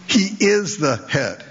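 "is" leaned on, slow and weighty; stress on "is". Shown as a demonstration. Preacher stressing the husband's headship, leaning on "is". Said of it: "It's an emphatic".

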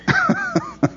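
laugh. Real, not acted. Laughs at own act.

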